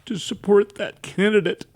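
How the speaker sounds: holding back tears while praising her; tearful. Real, not acted. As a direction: voice rising and catching with tears. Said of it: "that I'm so weepy".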